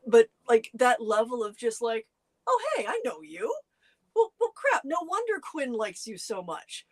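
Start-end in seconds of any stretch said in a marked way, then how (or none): none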